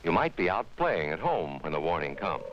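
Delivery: ordinary talk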